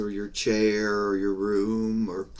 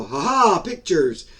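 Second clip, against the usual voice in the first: higher, louder mock eureka; mock sudden revelation; 6 semitones higher, 6 dB louder than usual. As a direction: burst out as if pictures just popped up. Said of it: "for the mind just to go".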